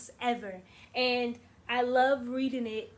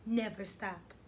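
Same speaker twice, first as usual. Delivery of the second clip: soft never stop; 4 semitones lower, 5 dB quieter than usual. Shown as a demonstration. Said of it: "repeated refrain, quiet take".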